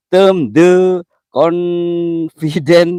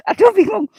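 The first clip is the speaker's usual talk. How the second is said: much higher, squeaky flustered aduh; flustered squeal; 5 dB louder than usual. Real, not acted. Streamer stuck reading English comments, squeaky flustered squeal. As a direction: squeal it out in embarrassed panic.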